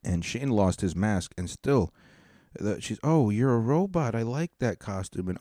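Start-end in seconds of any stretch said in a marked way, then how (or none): 3.0-5.3 higher-pitched, mock-gushing candy giver; gushing praise. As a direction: coo over a kid's costume, lightly imitated. Gushing over another kid's costume.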